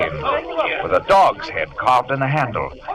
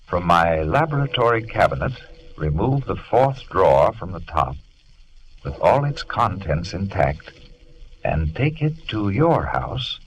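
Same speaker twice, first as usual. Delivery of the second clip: solemn letter reading; 9 semitones lower, 4 dB quieter than usual. Acted. Jekyll's letter to Lanyon, read out solemnly. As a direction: read it like a desperate last request.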